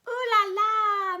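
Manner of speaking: high, loud delighted ooh la la. Acted. Nancy admiring her dressed-up family, high and loud. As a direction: squeal it like a fashion show.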